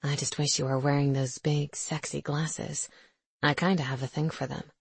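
low and soft, leaning in close. A narrator's reading. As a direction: drop the voice, intimate and hushed. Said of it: "He lowers his voice".

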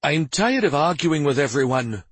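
exasperated and angry. A narrator's reading. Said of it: "Stavin said angrily".

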